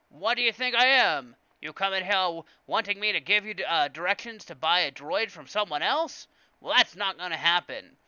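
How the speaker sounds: loud, high hammed-up indignant dealer; Watto. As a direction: rebuff the customer in a booming put-on accent. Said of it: "I can't do Watto's voice".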